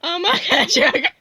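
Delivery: normal talking voice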